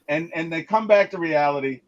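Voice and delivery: normal talking voice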